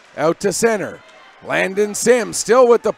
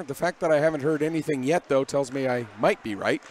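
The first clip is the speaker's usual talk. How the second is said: quiet aside; 6 semitones lower, 7 dB quieter, faster than usual. Real, not acted. Dry aside about pronunciations.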